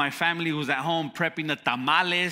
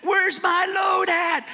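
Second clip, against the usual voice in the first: very high, louder, slow East Coast yell; East Coast shout; 5 dB louder, slower than usual. Retold or imitated. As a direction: shout the demand for the late load. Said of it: "when he was screaming at me".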